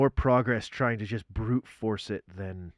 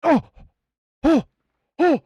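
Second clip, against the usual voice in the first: excited; 9 dB louder, faster than usual. Real, not acted. Cork finally moving.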